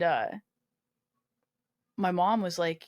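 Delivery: ordinary talk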